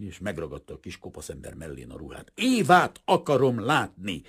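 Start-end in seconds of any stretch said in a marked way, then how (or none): 2.3-4.2 loud, high, angry insistence; furious demand. A narrator's reading. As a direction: shout it as an order. Painter shouts his demand.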